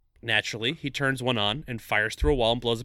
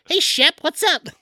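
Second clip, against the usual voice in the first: high, loud, slowed greeting; put-on high-pitched kid's shout; kids calling out; 14 semitones higher, 7 dB louder, slower than usual. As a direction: holler it like a kid to a pal. Voicing kids greeting Shep.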